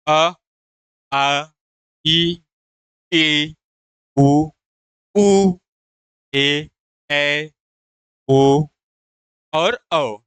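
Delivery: each vowel sound अ, आ, ई, ए, उ, ऐ, ओ, औ said singly with short pauses; reciting vowels one by one. Shown as a demonstration. Teacher reciting vowels for students, each one spaced out. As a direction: sound out every vowel clearly and separately. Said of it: "उच्चारण के आधार पर".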